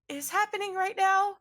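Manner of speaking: high, loud what-is-going-on; baffled shock. Acted. Mirsine seeing John collapse, high baffled shock. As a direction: blurt it out in disbelief. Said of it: "Mirsine is like".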